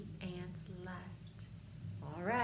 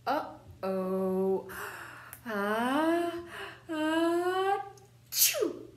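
fake sneeze; 10 semitones higher, 8 dB louder, much slower than usual. Acted sneeze after pepper.

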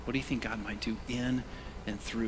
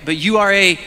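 louder call; 8 semitones higher, 15 dB louder than usual. Shown as a demonstration. Pastor repeating the verse with the crowd, louder. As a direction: shout the lead, then drop for "chosen people". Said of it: "say it one more time".

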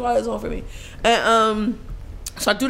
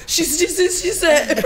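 high, slow stuttering; hammed-up flustered repetition, pitched up; mock panicked stammer; 8 semitones higher, slower than usual. Showing how she was on set, flustered. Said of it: "that is how I'm on set".

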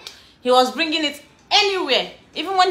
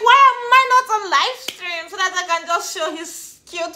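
laughing; 7 semitones higher, 4 dB louder than usual. Retold or imitated. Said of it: "I'm like".

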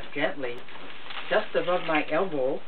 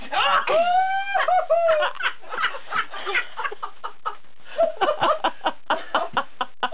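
long shrieking laughter. Real, not acted. Breaks down laughing mid-story.

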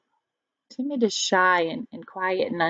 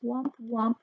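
creature cry; 3 semitones higher, 7 dB quieter than usual. Acted. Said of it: "they cried".

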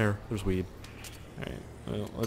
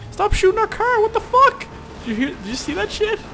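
shocked yell; 6 dB louder than usual. Real, not acted. Shocked yelling.

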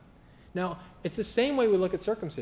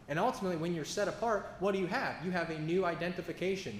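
ordinary delivery

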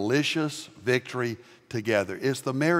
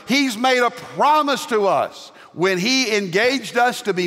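full-force preaching; 7 semitones higher, 3 dB louder than usual. Acted. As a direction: lift the congregation with rising fervour.

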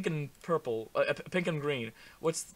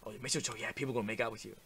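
usual speaking voice

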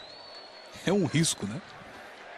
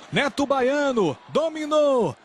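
rising, higher build to the goal; explosive goal commentary; 4 semitones higher than usual. Real, not acted. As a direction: climb to a shout on the strike.

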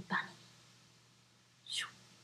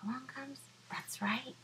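ordinary delivery

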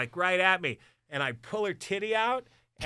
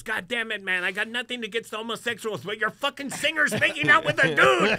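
imitated angry complaint, rising to loud and high; pissed-off promoter; 5 semitones higher, 5 dB louder than usual. Promoter complaining to Dan about his singer, mock angry. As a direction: build to an exasperated mock yell. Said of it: "fucking all pissed off".

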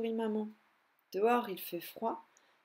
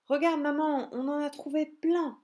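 put-on excited child squirrels; 5 semitones higher, 5 dB louder than usual. Squirrel kids back from gathering nuts.

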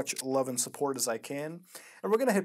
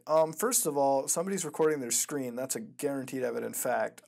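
normal talking voice

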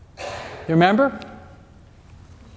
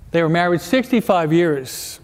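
ordinary delivery

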